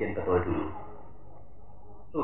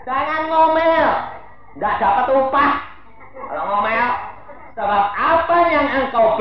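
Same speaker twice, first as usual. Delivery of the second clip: high, loud warning; loud high preaching; forceful warning; 12 semitones higher, 5 dB louder than usual. Acted. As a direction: exhort the church firmly.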